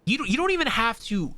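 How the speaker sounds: ordinary delivery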